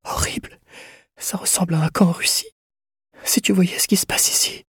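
high, whispery, frail voice; horrified murmur. A narrator's reading. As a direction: murmur it in horror, barely voiced. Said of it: "murmura-t-elle".

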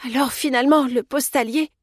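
mildly flustered; faltering. A narrator's reading. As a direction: falter as it dawns on you. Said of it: "balbutia Anna".

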